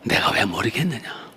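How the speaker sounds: hushed, low, gentle reassurance, sinking to a whisper; soft voice of the Lord. Retold or imitated. The Lord answering her prayer, low gentle near-whisper. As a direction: comfort her like a hushed father.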